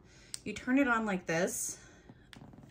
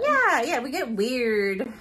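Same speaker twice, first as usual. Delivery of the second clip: excited; 4 semitones higher, 7 dB louder, slower than usual. Real, not acted. Excited agreement about getting weird.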